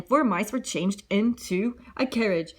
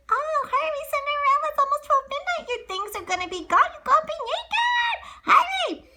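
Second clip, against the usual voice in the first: frantic shout. Acted. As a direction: yell at her to run. Mice urging Cinderella.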